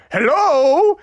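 high, loud drawn-out hello; dad as ghost. Acted. Dad in a sheet answers the door, ghostly greeting. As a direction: call it out like a spooky ghost. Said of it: "he opened the door and said".

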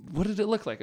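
lightly voiced small boy; young son asking. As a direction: ask it like an eight-year-old. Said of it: "Jarrett, our youngest, goes".

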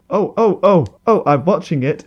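high, loud excited outburst. Real, not acted. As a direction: burst out in excitement. Excited discovery of no gun.

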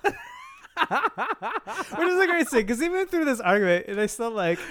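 high, laughing, words stretched out. Real, not acted. Telling a friend about a favourite scene, laughing. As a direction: crack up while talking.

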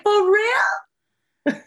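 high surprised "For real?"; astonished question; disbelief. Retold or imitated. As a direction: jump way up in disbelief. Hears about 3D printing.

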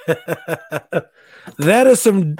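words stretched slowly through laughter; laughing. Real, not acted. Laughing at himself for showing off a sword, slow and giggly. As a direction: laugh at yourself while talking.

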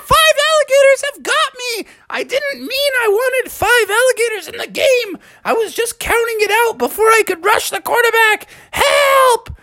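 very high cartoon screaming; shrieking, high-pitched comic panic; panicked. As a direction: wail for help while being attacked. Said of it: "and he was like".

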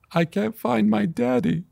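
put-on high, whimpering little-child voice; imitated tearful blurt. Lost boy answers the stranger through his tears, imitated tearful blurt. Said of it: "could barely blurt out, uh, through my tears".

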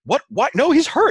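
very high, loud imitated panic; wife alarmed that the injured man is sent back, shrill panic; panicked wife. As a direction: squeal it in disbelief and alarm. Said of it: "she's like".

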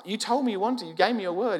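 high-pitched retold reproach; pleading protest. Seller holds buyer to promise.

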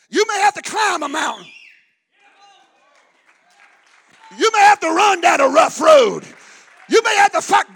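loud, high preaching shout; full-force preaching. Acted. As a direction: drive each line home. Preaching climax.

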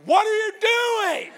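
shrieked high and startled, mock outrage; a squeaky, hammed-up "what are you doing"; startled outburst. Mock outburst at elevator stranger.

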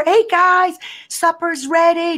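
high, loud call up the stairs. Retold or imitated. Mother calling the kids to supper, loud and high. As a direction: call the family down to eat.

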